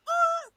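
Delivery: loud, very high-pitched "ah"; imitated screech of a getaway; high sudden yelp. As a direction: burst out a short high screech. Imitates the cab speeding off.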